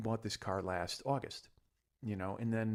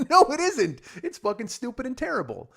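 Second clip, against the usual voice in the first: loud, high mock outrage; laughing disbelief pushed high and loud; exasperated protest; 13 semitones higher, 11 dB louder than usual. Retold protest at that praise.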